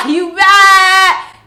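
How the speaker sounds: high, very loud drawn-out jeer; gleeful sing-song taunting yell; laughing taunt. Retold or imitated. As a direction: holler it mockingly through laughter. Acting out laughing taunt at woman.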